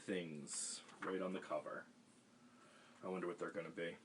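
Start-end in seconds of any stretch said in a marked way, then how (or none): none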